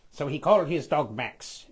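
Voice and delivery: ordinary delivery, nothing marked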